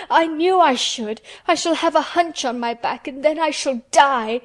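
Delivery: high-pitched despairing wail; choked out. A narrator's reading. Sobbing boy foretells his hunchback and death, choked. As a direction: wail it as certain doom. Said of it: "choked out Colin".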